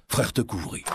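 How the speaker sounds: plain reading voice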